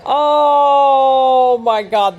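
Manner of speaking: drawn-out shout of disbelief; a long, loud, stunned oh my god. Real, not acted. Near fall, crowd erupts.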